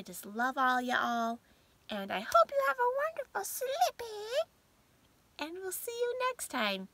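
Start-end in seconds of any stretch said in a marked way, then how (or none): 2.2-6.9 sing-song goodbye. Real, not acted. Signing off to viewers.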